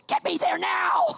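high, slow shouted order; hammed-up commanding yell; fierce demand. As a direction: demand it word by word. Demanding a rush to the plane.

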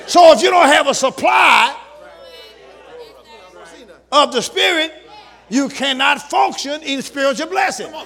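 loud, high exhortation from the pulpit; building pulpit shout; preaching at full force. Acted. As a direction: call the church to attention with fire. Pastor exhorts the congregation.

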